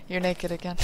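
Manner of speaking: imitating the unimpressed partner in a flat, quiet voice. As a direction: drop it quietly with no excitement. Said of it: "in, like, a flat voice".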